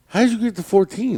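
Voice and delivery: high-pitched disbelief; incredulous doctor. Retold or imitated. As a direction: imitate a doctor taken aback. Retold eye doctor taken aback.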